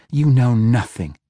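loud, cutting retort to Gromph; sharp retort. A narrator's reading. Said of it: "Catti Brie retorted".

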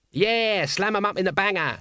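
high jeering shout. Acted. Nobby shouts at the prisoners, high and jeering. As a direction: yell after the prisoners. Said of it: "shouted Nobby".

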